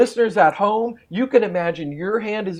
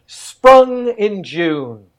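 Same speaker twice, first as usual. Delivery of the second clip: poem recital; much slower than usual. Shown as a demonstration. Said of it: "I'm gonna say a line of poetry".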